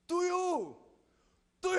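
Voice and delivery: put-on high, loud toddler cry for soy milk; toddler demanding. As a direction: squeal like a two-year-old wanting his drink. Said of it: "아침에 일어나자마자".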